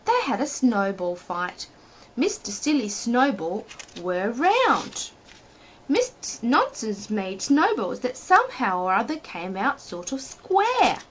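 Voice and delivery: plain reading voice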